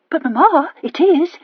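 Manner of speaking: high, loud insistence; insisting. A narrator's reading. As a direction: press the point on mother. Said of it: "insisted Charlotte".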